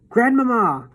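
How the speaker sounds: a high, loud calling shout. A narrator's reading. Boy shouts to his grandmother through the door, loud. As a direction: call through the door with everything. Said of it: "I shouted as loudly as I possibly could".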